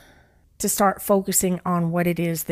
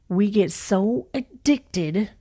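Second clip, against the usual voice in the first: slow emphatic third take; much slower than usual. Shown as a demonstration. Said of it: "I'm gonna say that again".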